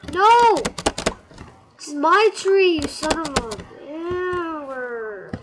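high, loud enraged shouting; real rage at a rival; furious yell. Real, not acted. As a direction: yell at the player stealing your tree. Someone takes his tree.

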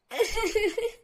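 shrieking mad laughter; loud maniacal laugh. Acted. Girlfriend laughing loudly and madly at her captive. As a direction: let out a loud crazed laugh.

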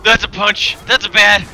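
alarmed shouting. Real, not acted. Player spotting a Graug mid-fight, alarmed shouting. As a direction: shout each warning as it hits you. Startled by enemies in game.

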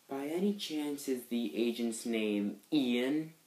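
slow, soft, mysterious probing question; too mysterious. Acted. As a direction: overplay the suspicion, slowly and quietly. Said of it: "that sounded too mysterious".

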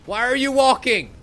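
loud, high exasperated yell at the screen; exasperated shout. Real, not acted. Gamer yelling at his walking character, exasperated shout. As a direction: demand it in frustration. Character walks during dragon chase.

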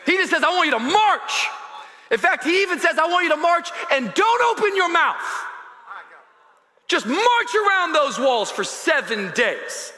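high, loud sermon delivery; full-force preaching. Acted.

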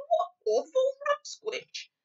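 animated giant climbing to high mock scorn; BFG. BFG imagining the Queen's reaction, scornful mimicry.

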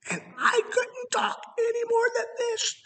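strained, thin and high after vocal polyps; damaged polyp voice. Shown as a demonstration. Preacher shows congregation his voice after polyps, strained and thin. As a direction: rasp it out as if the cords are half gone. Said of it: "I talked like that".

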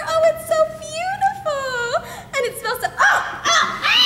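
rising, giddy rapture; swooning delight. Acted. An actress smelling the magic flower, swooning into laughter.

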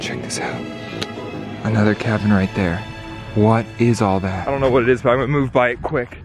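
ordinary talk

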